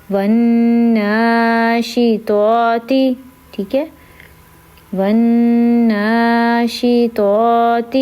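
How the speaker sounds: والناشطات with a humming nasal nn; nasal ghunna. Shown as a demonstration. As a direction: hold the doubled ن in والناشطات through the nose. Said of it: "اس پہ غنہ کیا میں نے، ناک میں آواز نکالی".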